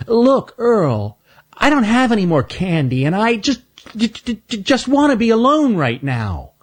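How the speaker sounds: high voice stuck on the j, then trailing off; a small hurt squirrel stammering; Stanley asks to be left alone, stammering; stammering young squirrel. Acted. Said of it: "Stuttering Stan".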